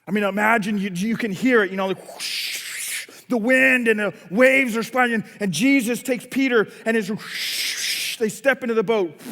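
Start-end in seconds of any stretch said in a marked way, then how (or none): 2.1-3.2 long breathy whoosh of crashing waves; whooshing wind. Retold or imitated. 7.1-8.2 long rushing whoosh; whooshing wind. Retold or imitated. Imitates wind and waves.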